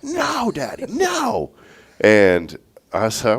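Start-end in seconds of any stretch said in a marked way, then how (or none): 0.0-1.5 very high whiny no, Daddy; mock child's wail. Father imitating daughter refusing the car, high childlike wail. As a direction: squeal it like the terrified little girl.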